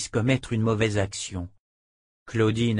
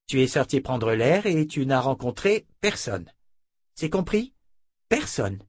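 scornful henchman; 6 semitones higher than usual. A narrator's reading. Fitch berating the tearful noblewoman, scornful and cold. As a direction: sneer at her to clean herself up. Fitch dictates her silence.

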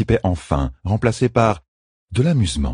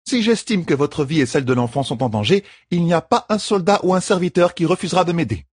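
higher, steady caregiver's voice; firm midwife; 11 semitones higher than usual. A narrator's reading. Midwife explains the treatment to the queen, firmly. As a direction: lay out the rules kindly but firmly.

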